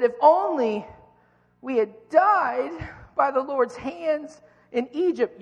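high, slow whining complaint; whiny voice. Acted. Israelites whining to Moses, high and whiny. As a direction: whine it like a spoiled complainer. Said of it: "You gotta read it with a whiny voice".

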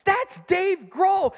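high, loud, slow starstruck call; thrilled fan; excited call. Retold or imitated. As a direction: shout it to the star himself. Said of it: "I said".

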